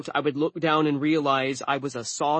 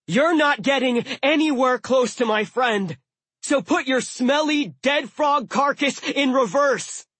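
high loud yell, slowing at the end; shouting; 11 semitones higher, 5 dB louder than usual. A narrator's reading. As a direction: bellow defiance at the beast. Dwarf shouting down a dragon.

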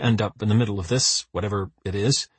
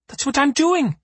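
frustrated. A narrator's reading. Frustrated driver arguing.

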